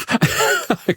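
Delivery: high laugh; high burst of laughter. Real, not acted. Laugh after the story.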